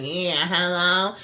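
mock-drunk phone hello, drawn out; tipsy caller. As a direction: drag the words like a tipsy late-night caller. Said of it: "you sound like you done had some damn sips".

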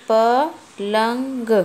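पलंग stretched very slowly, syllable by syllable; drawn-out teacher read; slow sounding out. Shown as a demonstration. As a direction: read पलंग out slowly so a child can follow. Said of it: "तो कैसे पढ़ेंगे?".

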